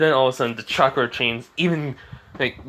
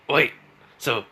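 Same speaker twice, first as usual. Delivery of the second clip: usual speaking voice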